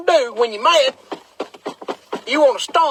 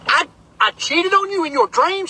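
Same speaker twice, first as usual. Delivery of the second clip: higher, baffled echo rising at the end; raised-pitch retold bewilderment; incredulous question; 4 semitones higher than usual. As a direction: repeat it back in mock disbelief. Acting his baffled reply to wife.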